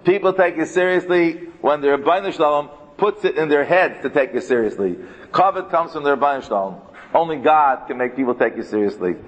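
ordinary delivery

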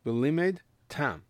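V'LIMED, pause, then TAM; as two words. Shown as a demonstration. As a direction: split it cleanly after "v'limed". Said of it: "He learns it as two words".

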